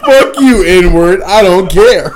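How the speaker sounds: loud, high mock defiance; hammed-up defiant outburst; defiant retort. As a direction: repeat the brash brush-off through laughter. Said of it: "He's like".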